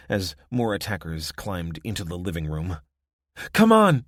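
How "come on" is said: loud urgent shout, high. Acted. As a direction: shout it to hurry him. Urgent call during fire escape.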